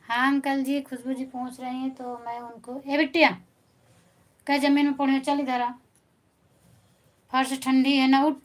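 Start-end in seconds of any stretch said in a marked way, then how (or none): 2.9-8.4 loud, higher call across the room; calling out to daughter. Real, not acted. Mother calls daughter off floor. (बेटी को ऊँची आवाज़ में पुकारना)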